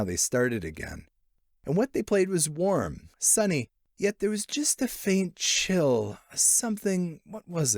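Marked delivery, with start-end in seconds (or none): none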